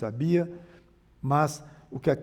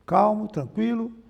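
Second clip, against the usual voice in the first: ordinary delivery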